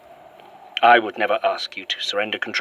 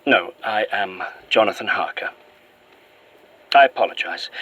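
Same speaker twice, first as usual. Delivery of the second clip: ordinary talk, nothing marked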